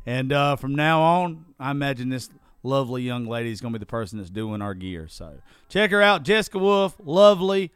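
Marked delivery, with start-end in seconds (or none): 5.7-7.7 loud shout-out. Real, not acted. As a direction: shout the plug like a hype man. Enthusiastic shout-out plug.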